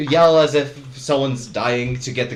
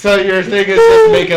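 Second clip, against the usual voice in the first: laughing question, higher and louder; 7 semitones higher, 7 dB louder than usual. Real, not acted. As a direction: laugh while asking.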